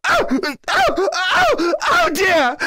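trapped king panicking in the dungeon, rising yells; panicked cries. Acted. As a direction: yelp as if something is coming for you. Locked in with the dungeon monster.

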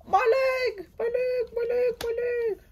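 very high squealing wail, over and over; pained cry. Acted. Injured toy fighter wailing about his leg, high mock shriek. Hurt character crying out.

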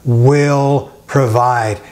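God will provide, drawn out and savored; very slow, savoring. Shown as a demonstration. Pastor savoring the promise for his listeners, very slow. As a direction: let each word linger. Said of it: "Doesn't that sound wonderful?".

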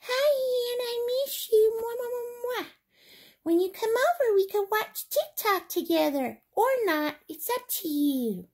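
high little zebra voice, sweet; zebra toy voice. Acted. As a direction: speak as the stuffed zebra to Hannah. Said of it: "here's little Zebra".

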